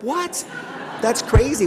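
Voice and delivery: mock disbelief of a teenager; stunned kid. High school kids hearing about party lines, high and incredulous.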